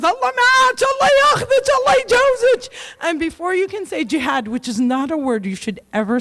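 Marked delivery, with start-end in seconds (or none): 0.0-2.7 high, loud shouted blessings; dad screaming. Retold or imitated. Dad shouting goodbye to her at the airport, loud. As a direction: bellow it across the drop-off. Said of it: "he screams".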